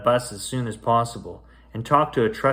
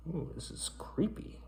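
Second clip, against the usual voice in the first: whispered aside; 5 semitones higher, 15 dB quieter, slower than usual. Real, not acted. Reader stepping out of the story to the kids, whispering. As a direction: lean in and whisper it.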